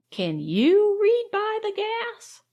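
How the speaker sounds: high, slow, drawn-out rebuke; reproving. A narrator's reading. Mrs Bushy turning the lodger's question back, reproving. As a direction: stretch the question out reprovingly, pitched well up. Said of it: "with a reproving look".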